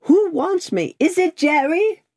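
high, mildly quavering questions. A narrator's reading. Passenger asks after the rider in the mist, mildly quavering. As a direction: call out uncertainly, pitched well up. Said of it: "then with mildly quavering speech".

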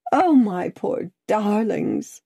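high, slow wail of pity; distraught lament. A narrator's reading. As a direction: lament them, drawing it out. Distraught woman lamenting absent friends.

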